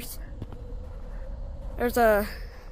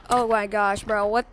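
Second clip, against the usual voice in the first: ordinary talk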